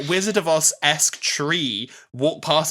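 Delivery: usual speaking voice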